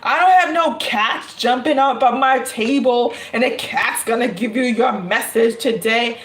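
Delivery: usual speaking voice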